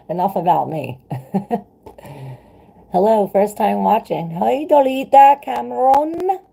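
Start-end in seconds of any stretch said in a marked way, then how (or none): 2.9-6.4 much higher, louder sing-song hello; bright loud hello to a newcomer; excited greeting. Real, not acted. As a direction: call out a big welcome. Greeting a new viewer.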